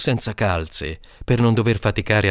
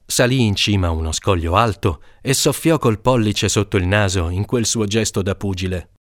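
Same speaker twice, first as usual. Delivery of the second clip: usual speaking voice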